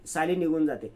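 ordinary delivery

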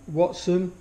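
ordinary talk, nothing marked

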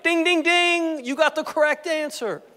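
playful, high-pitched congratulation; mock quiz-show cheer. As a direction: imitate a game-show bell, then announce the win. Jesus as quiz show host.

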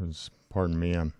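normal talking voice

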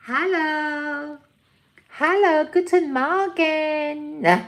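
loud, high, drawn-out hello; showy greeting. Acted. Owner greeting for her pet, loud high drawn-out hello. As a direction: sing out a big slow greeting.

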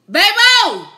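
loud high call to the next room; wife calls her husband from another room, loud call. Real, not acted. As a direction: shout for him across the house.

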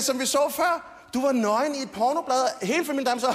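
ordinary talk

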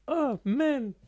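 high, soft drawn-out whine; stumped groaning. Acted. Interviewee put on the spot by a favourite-contestant question, stumped groaning. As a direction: agonise over the answer in a high, thin moan.

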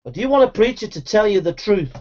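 ordinary delivery

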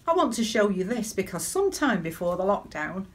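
normal talking voice

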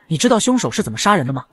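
plain reading voice

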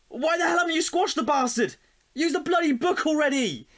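angry demand. Acted. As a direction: bark at them to squash it. Demanding they squash the roach.

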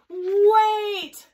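high, loud drawn-out call; full storybook shout. A narrator's reading. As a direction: yell it out to stop them. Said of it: "shouted a voice".

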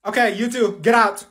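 usual speaking voice

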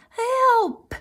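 very high cry; crying for help. A narrator's reading. Froggy calling to mother for rescue, high cry. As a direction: call out in high-pitched alarm. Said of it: "cried Froggy".

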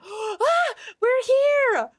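high and loud arrival cry; excited cry. Real, not acted. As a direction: burst out with joy at arriving. Arriving in the DLC.

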